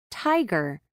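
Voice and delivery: "Tiger" opening on a puff of air; "Tiger" with an aspirated t. Shown as a demonstration. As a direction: release the T of "Tiger" with extra breath. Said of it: "with an extra puff of air".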